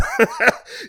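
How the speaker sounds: high-pitched burst of laughter; amused laugh. Real, not acted. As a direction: laugh mid-sentence, tickled. Amused while praising the listener.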